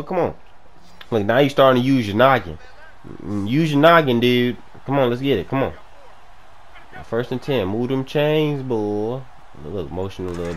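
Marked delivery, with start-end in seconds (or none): none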